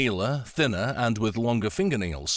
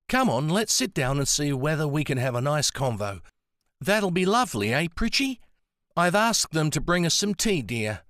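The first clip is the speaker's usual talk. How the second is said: put-on higher, friendly old lady with an Antipodean accent; Nancy's voice; 6 semitones higher than usual. A narrator's reading. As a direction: kind elderly woman speaking of an old friend. Nancy cheerily fussing.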